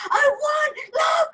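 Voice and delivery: high, loud mock cry of excitement; excited winner. Imitating Carolina winning at the machines, excited. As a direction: cry out as if you just won.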